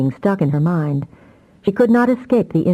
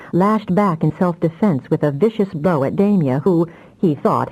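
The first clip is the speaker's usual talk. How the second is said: plain reading voice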